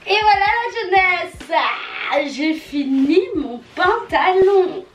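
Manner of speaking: high, drawn-out victory call; exultant cry. Real, not acted. Vlogger to viewers on finishing, exultant high cry. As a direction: belt out the win, stretching the words.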